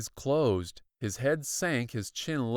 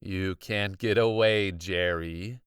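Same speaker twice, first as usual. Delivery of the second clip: low, even and unhurried; a cool villain, not out of breath; calm; 4 semitones lower than usual. Acted. Doctor calmly telling pinned boy he can't escape. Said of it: "he said calmly".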